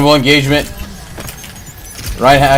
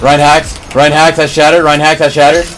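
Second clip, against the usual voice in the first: loud, high excited callout; 5 semitones higher, 6 dB louder than usual. Real, not acted. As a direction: call it out hyped.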